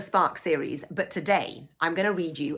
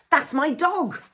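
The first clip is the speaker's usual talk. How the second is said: proud shout; 9 semitones higher, 3 dB louder, slower than usual. Acted. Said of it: "shouts Dad from the audience".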